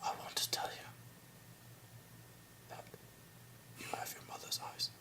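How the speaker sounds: barely audible mock-solemn whisper; intimate whisper. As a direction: whisper uncomfortably close, imitating a film character. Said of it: "they also get super close".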